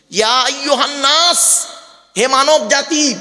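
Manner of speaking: slow chanted Arabic verse; Quran recitation. Acted.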